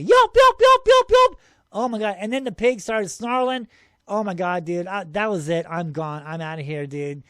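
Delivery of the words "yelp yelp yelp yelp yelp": high, loud yipping yelps; imitated coyote yelps. Mocking the coyote yelping at him on the mountain, high loud yips. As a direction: yelp like an angry coyote. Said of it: "He's all yelping at me".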